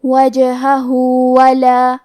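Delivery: وجہہ ولا with the final ہ stretched two counts into ولا; slow lengthened ہ in وجہہ ولا; two-count madd. Shown as a demonstration. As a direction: hold the ہ of وجہہ two beats. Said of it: "اپنی آواز دو مد کے برابر لمبی کریں گے".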